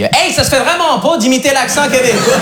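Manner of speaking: high, drawn-out mock Québécois accent; hammed-up Québécois friend scolding. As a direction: lay on a broad Québécois accent, slowly. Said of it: "il me l'a pas dit comme ça".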